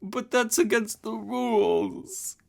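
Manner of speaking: high, slow blubbering excuse; sobbing. Acted. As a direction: whimper it through tears. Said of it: "sobbed Ozma".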